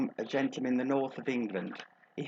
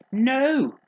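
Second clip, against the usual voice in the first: loud, high comic "no"; emphatic refusal; 9 semitones higher, 8 dB louder than usual. Acted. Agony aunt rejecting the bus pass idea, loud mock-horrified refusal. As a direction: cry out a horrified veto.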